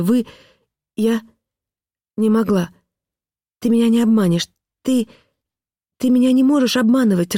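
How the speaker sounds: high, frantic pleading; begging to be told it is false; desperate pleading wife. A narrator's reading.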